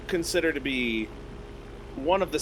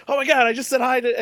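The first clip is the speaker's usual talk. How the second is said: giddy retelling; 9 semitones higher, 6 dB louder than usual. Retold or imitated. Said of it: "I'm like".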